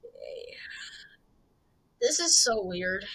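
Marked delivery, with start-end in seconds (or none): 0.0-0.5 hushed unvoiced okay; whisper. Real, not acted. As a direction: breathe it out silently. Hiding near guards.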